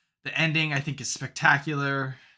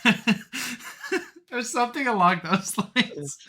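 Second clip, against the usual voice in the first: words through a laugh, higher and louder; laughing while talking; 10 semitones higher, 3 dB louder than usual. Real, not acted. Still laughing after quoting the film, laughing. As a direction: keep laughing as you speak.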